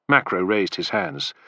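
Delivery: ordinary delivery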